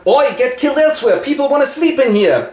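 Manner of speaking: high, loud mock yell; hammed-up annoyed neighbour calling out; shout from a window. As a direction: holler down from upstairs, irritated. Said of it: "shouts out of the window".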